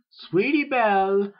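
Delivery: high, loud, drawn-out call. A narrator's reading. Sister calling the filly before she leaves, long high call. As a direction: sing out the name to someone across the house. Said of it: "a voice called".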